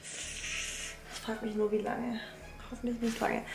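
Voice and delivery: hushed, breathy murmur to herself; half-whispered aside. Real, not acted. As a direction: drop almost to a whisper. Open fridge, talking to herself.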